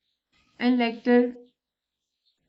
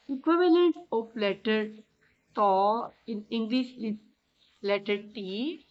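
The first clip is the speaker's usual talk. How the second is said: ordinary talk, nothing marked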